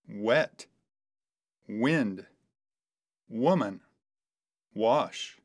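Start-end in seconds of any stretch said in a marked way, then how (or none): none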